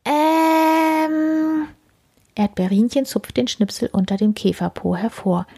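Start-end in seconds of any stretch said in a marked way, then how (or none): 0.0-1.7 high guilty hesitation; sheepish beetle um. Acted. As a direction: stall like a caught bug.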